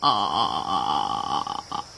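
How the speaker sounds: very low, sustained aah; hippo's open-mouth "aah". A narrator's reading. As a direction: open wide and hold a deep aah. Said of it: "opened his enormous mouth".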